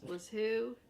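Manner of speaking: ordinary delivery, nothing marked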